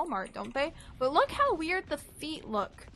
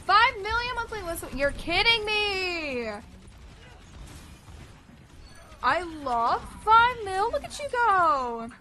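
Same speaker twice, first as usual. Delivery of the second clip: excited; 7 semitones higher, 6 dB louder than usual. Real, not acted. Amazed at listener count.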